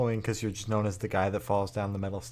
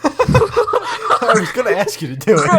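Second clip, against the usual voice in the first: laughing it out, higher and louder; 11 semitones higher, 8 dB louder, slower than usual. Real, not acted. Friend laughing at the throw-up offer, laughing. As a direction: giggle through the whole line.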